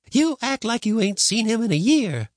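put-on high-pitched grumbling old woman. A narrator's reading. Woman at the door to boy with dog, grumbling. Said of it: "the woman grumbled at the door".

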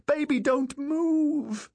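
very high, drawn-out motherly cry; panicked shriek. A narrator's reading. Mother warning her son up in the rigging, panicked shriek. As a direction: shriek a desperate warning to a child. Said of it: "Caroline shrieked".

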